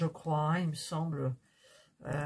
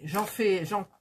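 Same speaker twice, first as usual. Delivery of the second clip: ordinary talk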